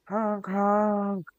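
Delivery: high loud honk noise. Pretend. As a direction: honk like a car horn. Imitating car horn.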